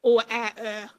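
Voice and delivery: "or ä, ö" said as the separate Swedish vowels å, ä, ö; Swedish letters. Shown as a demonstration. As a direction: sound out "or ä, ö" as Swedish alphabet letters. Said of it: "our three last letters in the alphabet".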